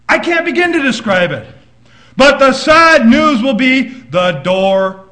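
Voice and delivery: loud, driving preacher's voice; fiery preaching. Acted. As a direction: proclaim it to the church. Preaching at full force.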